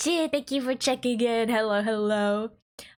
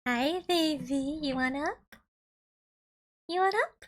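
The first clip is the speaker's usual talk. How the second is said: cat voice; 4 semitones higher than usual. Real, not acted. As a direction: lightly coo to the kitty. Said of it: "it's my cat voice".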